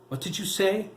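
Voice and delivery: angry mother bellowing at her son. A narrator's reading. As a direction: bellow it as a threat. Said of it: "Anna bellowed".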